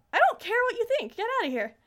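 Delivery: high, loud mock tantrum; acted-out child's outburst, pitch jumping up; angry rejection. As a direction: lash out and send them away. Corrupted Chibiusa rejects her mother, retold.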